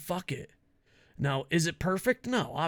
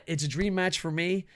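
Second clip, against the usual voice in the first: ordinary delivery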